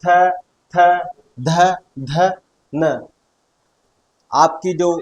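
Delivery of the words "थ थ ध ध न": थ, थ, ध, ध, न sounded out one by one with the tongue on the teeth; teacher sounding each dental letter on its own; dental consonant demo. Shown as a demonstration. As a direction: sound out थ, ध, न slowly and separately. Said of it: "त वर्ग को बोल के देखो".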